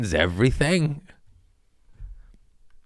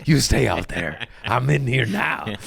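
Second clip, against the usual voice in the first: smug gatekeeping; 2 semitones higher than usual. Retold or imitated. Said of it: "You're like".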